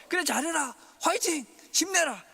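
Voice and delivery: high, loud cheering from far off; mock distant cheerleader. Pastor mimicking a God who only cheers from afar, high and loud.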